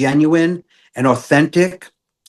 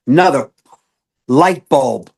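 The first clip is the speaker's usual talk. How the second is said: normal talking voice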